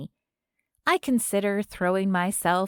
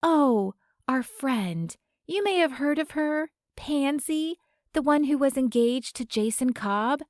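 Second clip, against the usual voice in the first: put-on higher, falsely sweet young fan; fake-friendly fan voice, pitched up; sugary fan voice; 5 semitones higher than usual. A narrator's reading. Fan lying to the older woman.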